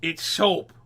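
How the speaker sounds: loud shocked shout; pirate and parrot shouting at the soap. Acted. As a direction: shout it in disgust. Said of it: "they both shouted".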